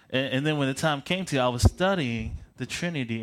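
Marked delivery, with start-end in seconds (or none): none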